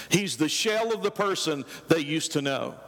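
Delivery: ordinary delivery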